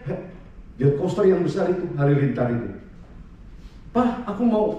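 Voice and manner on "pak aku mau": lightly voiced child, higher pitch; a hint of an eager kid asking dad; child's request, acted lightly; pleading child. Voices his child begging.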